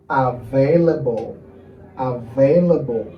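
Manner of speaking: slow, stretched syllables in "available"; "available" said slowly, syllable by syllable; slow model word. Shown as a demonstration. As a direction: draw out "available" for learners. Teacher repeats word for pronunciation.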